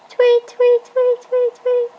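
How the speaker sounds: high chirpy tweets; bird tweet. Shown as a demonstration. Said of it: "Birds go".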